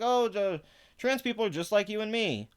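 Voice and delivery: high-pitched mock quote; mocking imitation. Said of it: "They're just like".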